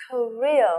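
Korea with the weight on RE; stress second syllable. Shown as a demonstration. Teacher drills Korea, stress on the second syllable. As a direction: lean on the middle of Korea. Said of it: "trọng âm thứ hai".

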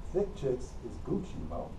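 ordinary delivery

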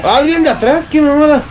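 very high-pitched indignant alarm; alarmed protest. Real, not acted. Gamer attacked from behind, alarmed protest.

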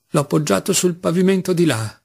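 ordinary delivery

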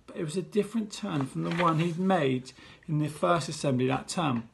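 ordinary delivery, nothing marked

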